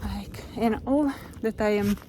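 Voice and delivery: normal talking voice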